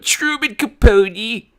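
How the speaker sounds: high, slow affected voice; hammed-up high-pitched impression; Truman Capote. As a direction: draw it out in a mannered high pitch. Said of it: "who talked like this".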